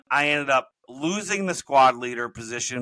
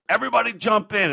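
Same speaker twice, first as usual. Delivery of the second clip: higher-pitched drill sergeant order; retold command, pitched up; called-out order; 9 semitones higher, 4 dB louder than usual. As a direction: call the squad into action. Drill sergeant ordering recruits in story.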